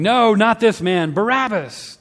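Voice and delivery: loud, high, drawn-out yell; crowd yelling. A narrator's reading. Judeans refusing Pilate's offer to free Jesus, yelling. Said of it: "they all started yelling".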